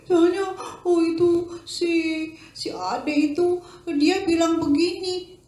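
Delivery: lightly voiced, high boy; upset child. Boy telling his mother about a bully, high voice. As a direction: tell on the classmate, hurt. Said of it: "kata si Dino".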